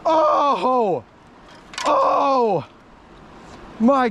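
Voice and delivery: high, excited cries of oh; excited gasps. Real, not acted. Angler landing a big catfish, high excited oh cries. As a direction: gasp out each oh as the fish comes up.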